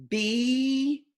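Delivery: sound-by-sound stretched reading. Shown as a demonstration. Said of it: "Let's stretch and read this word".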